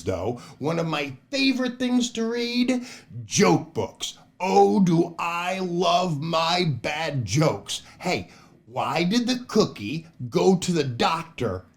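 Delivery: ordinary delivery, nothing marked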